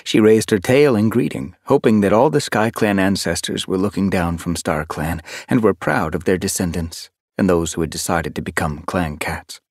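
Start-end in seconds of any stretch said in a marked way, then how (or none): none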